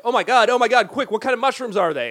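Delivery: high, urgent playacted alarm; mock panic. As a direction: ask it high and frantic, playing scared. Acts out sudden allergy alarm.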